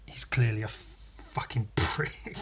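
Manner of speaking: slow whispered insult; conspiratorial whispered jab. Real, not acted. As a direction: hiss it under your breath. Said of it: "whispered insult".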